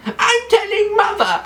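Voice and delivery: extremely high, squealed tattling; high-pitched whiny mock threat; Joffrey. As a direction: squeal like a spoilt brat running to mother. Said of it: "And he says things like".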